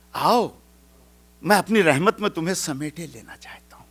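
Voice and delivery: normal talking voice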